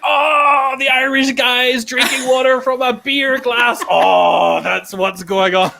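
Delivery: high, loud mock Italian teasing. Italian hosts mocking water in a beer glass, in a hammed-up Italian voice. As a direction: tease the Irish guy in a hammed-up Italian voice. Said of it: "they were like".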